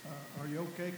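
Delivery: ordinary talk, nothing marked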